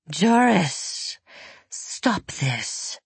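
commanding, otherworldly woman; a calm saint restraining him. Acted. Voices Elizaveta.

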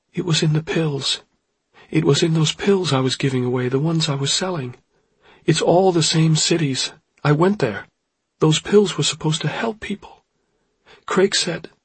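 high, distraught woman's voice; tearful confession, acted; Oryx crying. A narrator's reading. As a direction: pour it out in a high, upset voice. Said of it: "Oryx was crying".

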